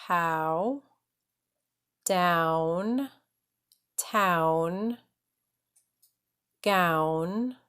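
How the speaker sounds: each ow word said slowly and separately; spaced drill list, one word at a time. Shown as a demonstration. Teacher reads students an ow word list, word by word. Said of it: "So let's do these".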